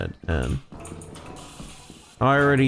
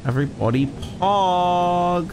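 higher, slow drawn-out chat hype; hype call; 6 semitones higher, slower than usual. Real, not acted. Streamer calling chat to celebrate, drawn-out hype. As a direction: stretch it out to rally chat.

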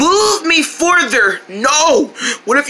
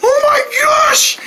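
screaming; 8 semitones higher, much slower than usual. Acted. Screaming while falling.

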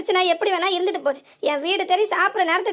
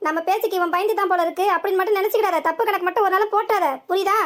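normal talking voice

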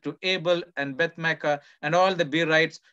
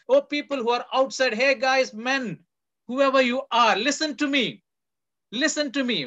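higher and louder, calling over the wall; crying out; 6 semitones higher, 6 dB louder than usual. Retold or imitated. The wise woman calling to the army outside the walls, crying out. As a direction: call out to the men outside the city. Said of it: "she cries out from the city".

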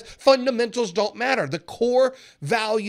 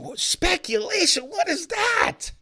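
much higher, puzzled squeak of disbelief; mock bafflement; 11 semitones higher, slower than usual. Imagined viewer reacting to the answer, mock baffled. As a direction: play a confused viewer hearing it.

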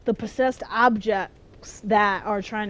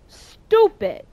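high, louder snap; annoyed outburst; 9 semitones higher, 5 dB louder than usual. Real, not acted. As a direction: spit it out. Annoyed at birds.

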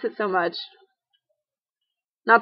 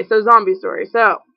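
normal talking voice

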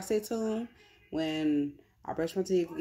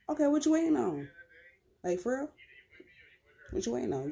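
exasperated; 7 semitones higher, faster than usual. Real, not acted. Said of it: "exasperated rant at viewers".

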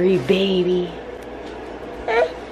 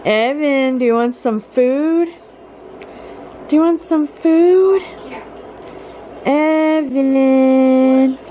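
calling out; 10 semitones higher, slower than usual. Real, not acted. Calling toddler over.

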